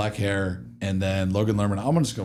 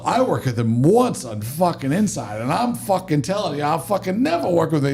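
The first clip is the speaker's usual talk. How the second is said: put-on agitated Chicago accent, pitched up; high, heated mock Chicago accent; 6 semitones higher than usual. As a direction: imitate a worked-up Chicago guy swearing off someone. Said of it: "He's Chicago accent".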